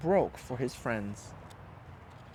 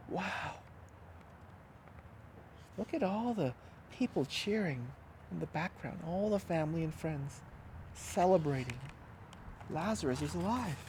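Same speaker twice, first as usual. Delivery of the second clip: hushed wonder; 3 semitones higher, 8 dB quieter than usual. A narrator's reading. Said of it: "soft aside to listeners".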